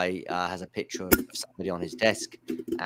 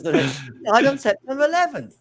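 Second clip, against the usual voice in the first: much higher, laughing mock outrage; laughing through the quote; 14 semitones higher, 5 dB louder, slower than usual. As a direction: crack up while squeaking out the line. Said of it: "then Larry's like".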